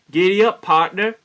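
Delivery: loud, lifted call to a stranger; hearty cowboy hail. Retold or imitated. Narrator greeting a man he passes, hearty holler.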